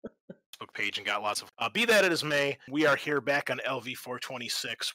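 ordinary talk

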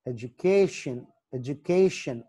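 slow education, weight on "ca"; education with a stressed "kay", then falling. Shown as a demonstration. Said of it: "సి దగ్గర".

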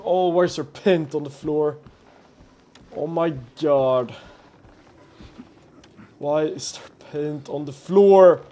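ordinary delivery

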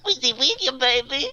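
high playful squeak; silly high voice. Pretend. Silly high character line.

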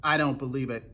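ordinary delivery, nothing marked

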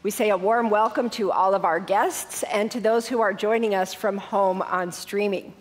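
ordinary delivery

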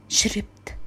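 softly whispered "شربت" in shame; whispered confession. Acted. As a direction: let "شربت" slip out as a guilty whisper. Confessing addiction in sad story.

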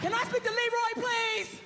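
loud and high, shouting for Leroy; loud demanding wife. Retold or imitated. Angry wife at husband's workplace, loud demand. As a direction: storm in and demand him loudly.

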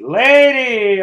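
LADY called out loud with a falling tune; proud loud announcement of the word Lady. Shown as a demonstration. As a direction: boom out Lady as the winning answer.

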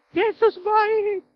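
high, drawn-out mock praise; sing-song it like a delighted crowd; imitated gushing praise, stretched out; gushing worshipper. Mimics the blessed crowd praising.

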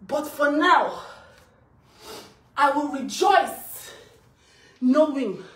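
loud, emphatic victory declaration; triunfante. Real, not acted. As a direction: proclaim it loudly, drawing out the words.

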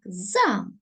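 Замок said crisply with the stress on ЗА; Замок clipped short, ЗА stressed; stress shown. Shown as a demonstration. Teacher showing the stress for pupils, crisp Замок. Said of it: "тут вже наголос є ось так".

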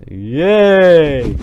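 long, drawn-out loud yay; cheer. Real, not acted. Player cheering in the game, long excited cheer. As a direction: cheer with a swooping rise and fall.

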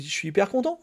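normal talking voice

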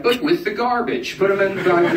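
high mock bouncer voice. Bouncers deciding where to dump the drunk, high mock voice. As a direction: toss it off like a smug bouncer. Club staff quoted.

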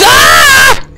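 sentence cut off by a high yelp; startled yelp. Real, not acted. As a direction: jump at the sudden hit. Startled mid-sentence by attack.

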